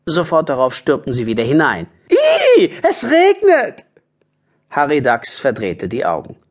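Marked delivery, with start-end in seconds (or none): 2.1-3.9 very high, loud, altered voice; disgusted badger squeal. Badgers rush back inside from the rain, disgusted squeal. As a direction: shriek in disgust.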